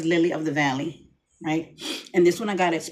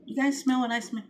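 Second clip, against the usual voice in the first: higher, softer curious aside; passerby remark; 7 semitones higher, 4 dB quieter than usual. Retold or imitated. Passerby asking a friend about the scent, hushed and higher. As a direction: lean in and ask a friend, intrigued. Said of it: "looks at the other person and goes".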